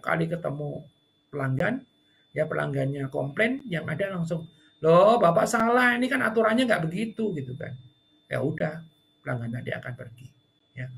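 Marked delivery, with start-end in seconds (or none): 4.8-7.3 higher, louder, slowed reproach; untrained staff arguing with a customer, mock reproach; defensive staff. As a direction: correct the customer in a lecturing tone.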